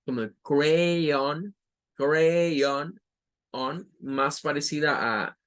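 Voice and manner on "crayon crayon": crayon slow, ending on a clear on; crayon, the last syllable like Spanish on. Shown as a demonstration. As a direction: crayon demonstrated slowly. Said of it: "con el sonido ao".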